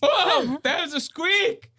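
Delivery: very high, squeaky teasing voice; mock pubescent squeak. Teasing a man still going through puberty, mock squeak. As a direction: squeal it like a cracking adolescent voice. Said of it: "Somebody's still going through puberty".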